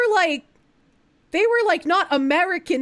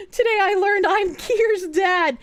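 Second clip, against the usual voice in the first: ordinary talk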